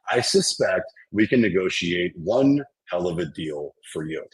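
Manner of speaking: ordinary talk, nothing marked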